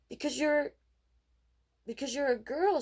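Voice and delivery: put-on a girl setting Casey straight; little girl. A narrator's reading. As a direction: answer like an obvious fact. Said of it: "she answered".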